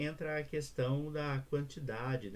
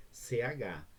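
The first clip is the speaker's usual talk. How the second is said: ordinary talk, nothing marked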